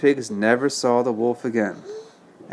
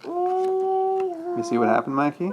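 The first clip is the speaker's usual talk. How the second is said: high sing-song question; 5 dB quieter, slower than usual. Real, not acted. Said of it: "asks child in high voice".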